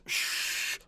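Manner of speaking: long, soft shush; hushing shush. Acted. Father silences soldier, sharp shush.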